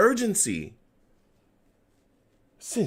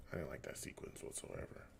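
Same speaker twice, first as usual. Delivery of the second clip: low mutter; 24 dB quieter, faster than usual. Real, not acted. Grumbling over a bad play.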